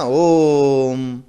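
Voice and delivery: ओम held long and slow; drawn-out om. Shown as a demonstration. Teacher showing a long ओम to students. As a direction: stretch out ओम as a pluta vowel. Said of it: "जब उसको देर तक कहते हैं".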